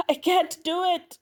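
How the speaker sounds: high, whispery, panicked plea; acted breathless despair. A narrator's reading. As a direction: whimper it slowly, high and airy. Panicking at the rope.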